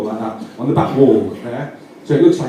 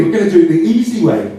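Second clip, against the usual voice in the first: normal talking voice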